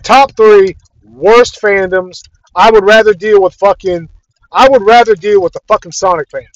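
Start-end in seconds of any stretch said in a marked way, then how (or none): 0.0-2.2 slow emphatic. Real, not acted. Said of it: "I one hundred percent mean that".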